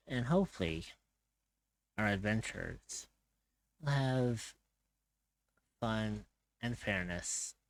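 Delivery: dramatic storyteller scene setting; game master narration. Acted. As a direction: set the scene like an epic tale. DM opening narration.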